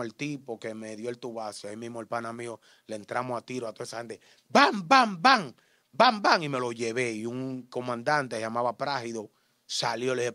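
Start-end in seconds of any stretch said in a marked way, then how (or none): 4.5-6.4 loud high shouted bam bam; gunshot sounds. Retold or imitated. Imita los disparos. (disparos imitados a gritos)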